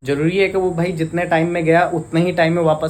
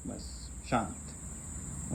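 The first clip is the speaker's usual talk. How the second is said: whispered hush; whispered calm; 5 semitones lower, 18 dB quieter, much slower than usual. Real, not acted. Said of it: "मैं डांटूंगा ना".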